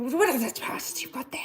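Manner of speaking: a hushed, secretive mock whisper. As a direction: whisper it like gossip about other people. Voicing people who run around.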